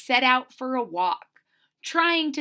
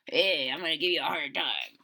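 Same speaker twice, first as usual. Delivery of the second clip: much lower, fast tough-guy voice; 9 semitones lower, 4 dB quieter, faster than usual. Shown as a demonstration. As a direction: growl it low like a tough guy. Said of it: "he's being a real tough guy".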